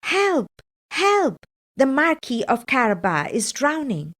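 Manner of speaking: high, loud, urgent calls of Help; shouted cry for help. A narrator's reading. Cat shouting to the king's carriage for help, loud and high. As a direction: yell for help as if someone is drowning. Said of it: "and shouted".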